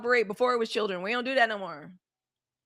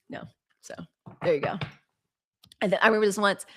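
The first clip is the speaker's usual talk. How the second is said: ordinary delivery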